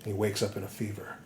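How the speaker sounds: usual speaking voice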